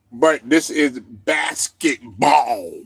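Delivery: a light impression, slow and low; Avery Johnson voice. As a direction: drawl it out like the old coach. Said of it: "in my Avery Johnson voice".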